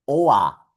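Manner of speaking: "O A" with the R turned into a short A; vocalized R after O; German -or ending. Shown as a demonstration. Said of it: "va a sonar".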